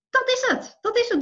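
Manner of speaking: high, delighted exclamation; excited. Retold or imitated. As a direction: burst out with glee. Client delighted, retold. (opgetogen uitroep)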